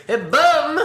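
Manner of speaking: high, loud comic call; Clouseau impression. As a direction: yell it like a clueless inspector. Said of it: "and he's like".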